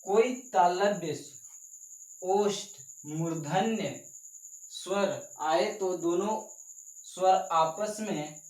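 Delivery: slow, word by word for note-taking; dictating slowly so the class can write; slow dictation. Shown as a demonstration. Teacher dictates to students, slow and spaced. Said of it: "हाँ, लिख लीजिएगा".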